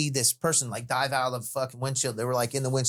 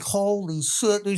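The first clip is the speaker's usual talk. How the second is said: slow, higher retold astonishment; stunned disbelief; 7 semitones higher, slower than usual. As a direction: draw out the mock shock. Reacting to the agent reveal.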